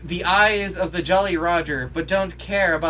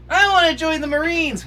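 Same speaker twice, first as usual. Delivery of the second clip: mock eager kid; 12 semitones higher, 6 dB louder than usual. Mimicking the boy's eager line.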